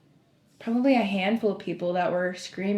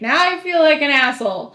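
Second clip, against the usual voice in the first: higher, louder retold shout; yelling back; 6 semitones higher, 6 dB louder than usual. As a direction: imitate a trooper calling back ruefully. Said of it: "he yells back".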